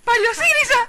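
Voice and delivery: high, very loud yell; furious high shout; screamed insult. Acted.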